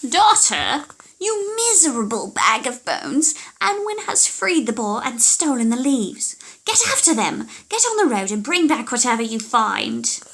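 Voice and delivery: loud, high witch barking orders; angry old witch yelling; furious witch. Acted. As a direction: scream orders at the daughter. Witch orders her daughter.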